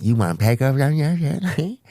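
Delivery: casual offer in a mock server voice; waiter's voice. Server offering pickles, lightly voiced. Server line repeated as punchline.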